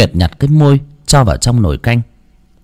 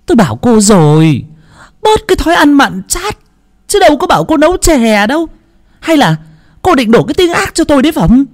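shouting mother-in-law. A narrator's reading. Mother-in-law shouts at her daughter-in-law, accusing. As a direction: scold her sharply. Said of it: "mà quát".

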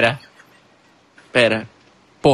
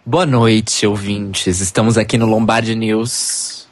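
low, slow news anchor voice; deep news voice; 5 semitones lower than usual. Pretend. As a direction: play a solemn TV newsreader. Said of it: "minha voz grossa".

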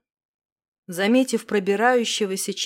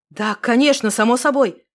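quick, louder eager yes; hasty eager agreement; 4 semitones higher, 7 dB louder than usual. Acted. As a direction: rush to agree. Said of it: "поспешил согласиться я".

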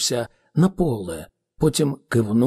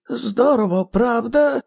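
much higher, eager voice; enthusiastic question; 10 semitones higher than usual. A narrator's reading. Ghost asks the frightened man if it's great, enthusiastic. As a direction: pitch it high, inviting agreement.

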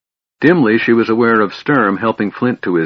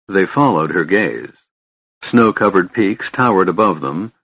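ordinary delivery, nothing marked

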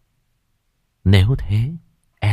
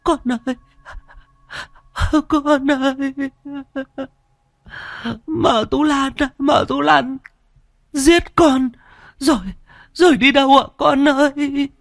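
tearful wailing. Acted. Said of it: "Chị Trực mếu máo".

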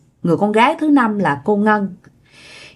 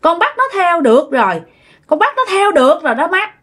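put-on a lightly raised, pleased girl's voice; satisfied ghost voice; 8 semitones higher than usual. A narrator's reading. Daughter's ghost tells mother, pleased, in a dream. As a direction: sound smugly content calling mother. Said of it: "nói với cái giọng rất là mãn nguyện".

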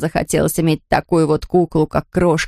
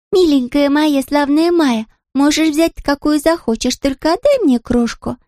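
put-on high coaxing child voice; pleading princess; 8 semitones higher than usual. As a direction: sweet-talk the friend, high. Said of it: "попросила принцесса". (высокий упрашивающий голос)

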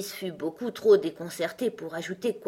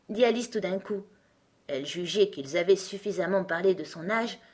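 ordinary delivery, nothing marked

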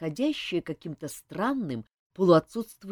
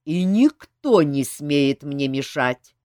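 slow, louder threat; menacing; 4 semitones lower, 7 dB louder, slower than usual. A narrator's reading. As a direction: warn them off, menacing. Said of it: "медленно и угрожающе произнёс он".

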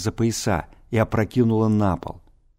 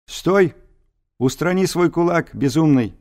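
put-on lofty, declaiming old scholar; pompous senator oratory; 7 semitones higher, 3 dB louder than usual. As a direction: proclaim it like a grand orator. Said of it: "тем же напыщенным тоном".

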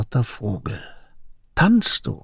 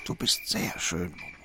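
whispered softly, gently reassuring; tender whisper; 10 semitones lower, 12 dB quieter than usual. Acted. Old man whispers tenderly to the boy asking if he's handsome. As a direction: breathe it to him like a secret.

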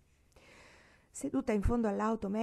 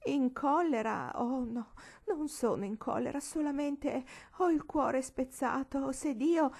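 heartbroken; 8 semitones higher than usual. Acted. Offended lady laments heartbreak.

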